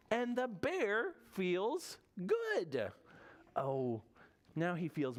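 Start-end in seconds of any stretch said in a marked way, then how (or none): 0.1-2.9 slow, high, stretched-out storybook reveal; happy reveal. A narrator's reading. Storyteller reveals Bear has recovered, slow high reveal. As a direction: draw it out, rising high toward the good news.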